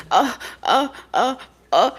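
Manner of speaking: high fretful uh-uh sounds of a worried mom; anxious mother's fretting. Retold or imitated. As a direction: fret like a nervous mama. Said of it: "That's how she sound".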